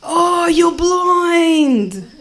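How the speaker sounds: high, loud kid impression; Lebo kid. Lebo kid meeting blind neighbour, high excited voice. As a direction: stretch it out, loud and amazed. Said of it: "Lebo kid down my street".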